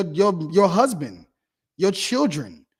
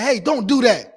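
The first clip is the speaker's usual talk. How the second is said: mock yell; 5 semitones higher, 5 dB louder than usual. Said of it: "if you start yelling at".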